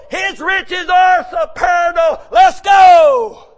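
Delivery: slow, loud preacher proclamation; preaching. Acted. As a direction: hammer it home to the church. Preaching at full force.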